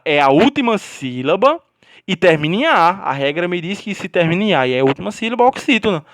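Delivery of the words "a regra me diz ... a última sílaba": ordinary talk, nothing marked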